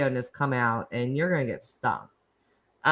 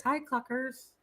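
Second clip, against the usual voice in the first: cutesy greeting; 9 semitones higher, 9 dB quieter than usual. Real, not acted. Greeting a viewer in chat.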